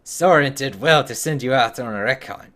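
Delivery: slightly Russian-sounding attempt at a dwarf accent; amateur dwarf accent slipping towards Russian; a little bit Russian. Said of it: "gonna have to be a little bit Russian".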